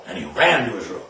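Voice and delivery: ordinary talk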